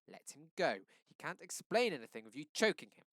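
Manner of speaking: loud, high command. A narrator's reading. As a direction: order him to let go. Mama ordering Daddy.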